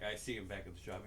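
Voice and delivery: ordinary delivery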